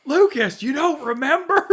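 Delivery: high, loud exasperated dad. Retold or imitated. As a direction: scold like an annoyed father. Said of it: "I could just hear my dad being like".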